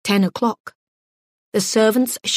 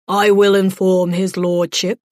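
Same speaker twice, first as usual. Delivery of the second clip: cold, louder butler voice; coldly; 5 dB louder than usual. A narrator's reading. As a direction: stiff and icy, a loud formal reply. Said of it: "Dreadwort said coldly".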